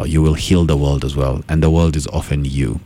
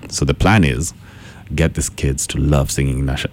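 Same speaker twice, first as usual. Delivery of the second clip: ordinary talk, nothing marked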